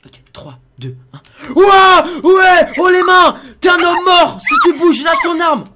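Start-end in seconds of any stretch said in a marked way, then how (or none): 1.5-5.6 full-volume high battle yell; shouting threat. Pretend. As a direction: storm in screaming to scare him. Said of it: "si j'arrive en criant très fort". (hurlement menaçant à pleine voix)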